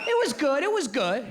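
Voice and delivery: high, lukewarm dad praise; dad's faint praise. Retold or imitated. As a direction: lift him up before the kick. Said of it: "That's what Italians do".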